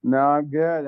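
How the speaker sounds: ordinary delivery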